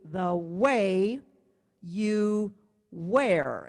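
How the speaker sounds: WAY stretched out on the beat, THE short before it; stress on WAY. Shown as a demonstration. Teacher placing words on the beats, stress on WAY and WEAR. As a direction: count it out slowly, landing long on WAY and WEAR. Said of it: "is the emphasis on beat two".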